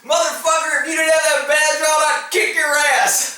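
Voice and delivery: mock-angry taunt; retold angry yell. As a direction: yell it out like a man picking a fight. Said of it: "this guy yells out".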